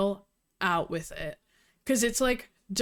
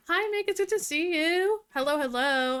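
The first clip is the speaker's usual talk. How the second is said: bright high hello to a friend; excited greeting; 7 semitones higher than usual. Real, not acted. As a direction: greet them with delight. Greeting a stream guest.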